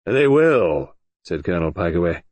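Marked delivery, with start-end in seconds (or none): 0.1-1.0 louder, matter-of-fact; dry and certain. Acted. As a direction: state it bluntly. Pikeaway repeats flatly.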